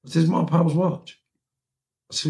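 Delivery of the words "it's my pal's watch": high-pitched indignant insistence; exasperated, pitched right up; acted protest. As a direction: re-enact protesting in an argument. Acted quarrel.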